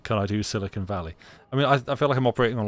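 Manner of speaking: ordinary talk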